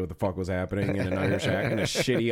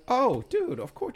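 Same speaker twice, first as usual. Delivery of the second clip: high-pitched, eager mock sympathy; eagerly understanding; slower than usual. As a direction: jump in high, all understanding. Acting out sympathetic customers' reply.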